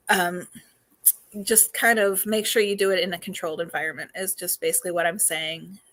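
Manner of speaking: normal talking voice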